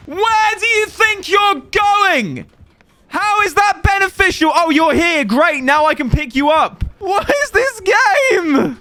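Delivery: high, loud and drawn out; exasperated yelling. Real, not acted. Streamer yelling at the game, high and loud. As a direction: rant at the screen, pitch up. Frustrated yelling at killer, teammates.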